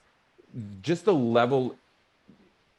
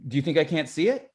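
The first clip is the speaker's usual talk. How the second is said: heated repetition exercise; 4 semitones higher than usual. Shown as a demonstration. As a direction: push back, let it escalate. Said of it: "let's do one right now".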